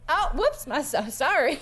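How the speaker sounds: high, hurried oops; stumbling, high-pitched sorry; flustered apology. Acted. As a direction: back out apologising, embarrassed. Skinny apologises for barging in.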